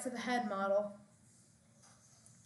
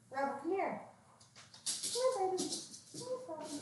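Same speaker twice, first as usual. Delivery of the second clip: high sing-song coaxing; calling a dog; 8 semitones higher, 4 dB quieter, faster than usual. Real, not acted. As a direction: coax a puppy over, pitched up. Coaxing dog to come over.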